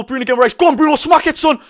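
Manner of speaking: building to a high, loud shout; excited urging. Real, not acted. Fan urging Bruno to smack it, excited shout. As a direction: yell him on to shoot, rising in pitch.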